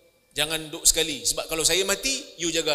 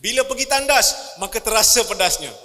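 comic emphasis; 3 semitones higher, 8 dB louder than usual. Acted. Comic punchline to the congregation.

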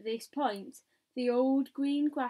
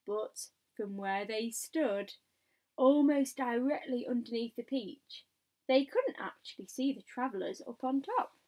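plain reading voice

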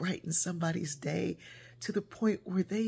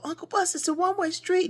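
put-on kids' protest; 12 semitones higher than usual. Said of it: "and we'd go".

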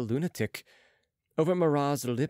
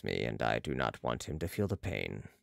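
low character voice, sinking softer; Balsamo resolved; 14 semitones lower, 7 dB quieter than usual. A narrator's reading. Balsamo tells Marat of the young patient, low resolve. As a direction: drop deep and quiet by the end.

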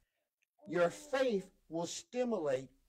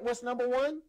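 normal talking voice

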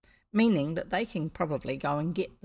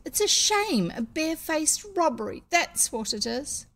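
put-on high, louder childish outrage; indignant girl; 8 semitones higher, 4 dB louder than usual. A narrator's reading. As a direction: protest it as a cheated child. Jane protests the robbery.

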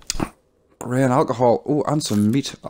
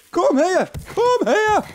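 squeaky high-pitched calling; calling chickens; much slower than usual. Real, not acted. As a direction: call it in a tiny high voice.

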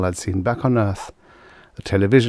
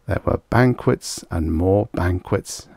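ordinary delivery, nothing marked

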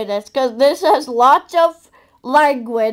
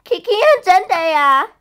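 higher, lively introduction; perky announcement; 4 semitones higher than usual. Pretend. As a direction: announce the names with pep. Second take, after "Oh, yeah.

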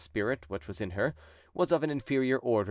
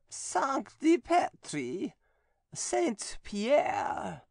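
much higher, slow, eerie possessed voice; possessed nun; 13 semitones higher, much slower than usual. A narrator's reading. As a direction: speak as the demon inside her, slowly. Possessed nun answers exorcist.

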